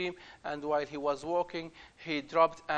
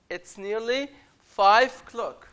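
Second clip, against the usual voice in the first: called announcement; 5 semitones higher, 9 dB louder than usual. Acted. Guide calling the boys.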